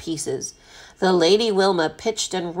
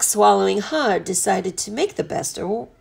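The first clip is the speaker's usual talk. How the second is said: ordinary delivery, nothing marked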